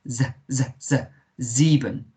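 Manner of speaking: repeated buzzing "z", then "sieben" starting with z; "sieben" with its s voiced as z; Z sound. Shown as a demonstration. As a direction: buzz "z" separately before saying "sieben". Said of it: "pronounced like a Z".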